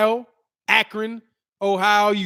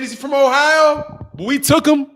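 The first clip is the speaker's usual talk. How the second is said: ordinary talk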